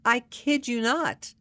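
usual speaking voice

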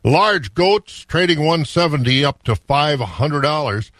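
ordinary delivery

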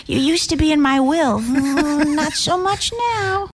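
high, put-on grandma, drawn out at the end; mock grandma voice. Host as grandma cutting grandchild out of the will, high mock voice. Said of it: "and her grandma's like".

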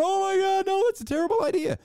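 high-pitched, hurried mock panic; mock freak-out. Imitating fans panicking over a trade, hammed-up freak-out. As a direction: squeal it like an overwrought objector. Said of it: "Who are you freaking out over, going".

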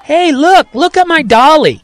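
put-on high, loud childish call; excited kid yell. A narrator's reading. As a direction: show off the doll like a thrilled child.

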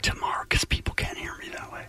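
hushed secret-telling whisper; conspiratorial whisper. Pretend. Radio host teasing tomorrow's announcement, whispering. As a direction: whisper it like classified news. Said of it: "Why are you whispering?".

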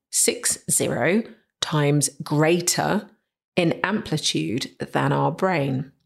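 ordinary delivery

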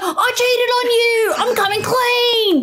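high, drawn-out comic confession; Dave Hughes. Pretend. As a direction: parody a comedian failing to sound serious. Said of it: "trying to talk to his wife".

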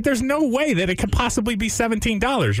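high incredulous protest; disbelieving. Retold or imitated. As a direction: voice climbing in disbelief. Quoting own disbelief.

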